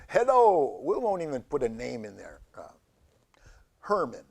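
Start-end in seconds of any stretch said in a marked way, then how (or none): 0.1-0.8 louder, lightly spooky hello; talking cancer voice. Acted. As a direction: call out as the cancer. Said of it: "well, it just".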